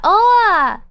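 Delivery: ordinary talk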